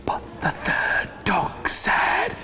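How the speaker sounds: slow, suspenseful stage whisper; hushed build-up. A narrator's reading. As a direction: whisper it slowly, setting up a surprise. Hushed build-up to big quack.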